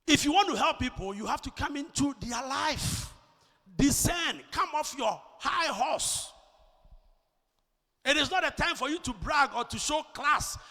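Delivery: high, loud exhortation; emphatic pulpit voice; forceful preaching. Real, not acted. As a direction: urge the congregation with force.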